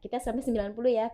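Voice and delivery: ordinary talk, nothing marked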